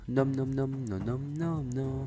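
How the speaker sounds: slow, soft chomping noises; munch it out playfully; nom nom chomping. Pretend. Silly chomping outro.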